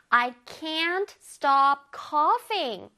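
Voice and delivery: very slow, careful English; slow teacher demo; slow model reading. Shown as a demonstration. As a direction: read each word slowly for students. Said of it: "선생님이 준비해봤어".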